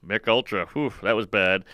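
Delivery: low, deflated echo of a punchline; mock a failed joke under your breath; taunting mimicry. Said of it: "they mimic my bomb".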